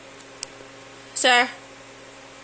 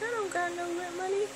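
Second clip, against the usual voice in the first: higher, softer voice for the dog, lightly done; voicing the dog; 7 semitones higher, 6 dB quieter than usual. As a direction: pitch it up as a sheepish pup. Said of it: "He's like".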